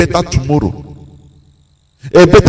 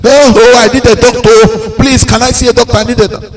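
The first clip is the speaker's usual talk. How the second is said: higher mock cries for a doctor; retold shouting; 7 semitones higher than usual. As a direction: plead like the frightened man.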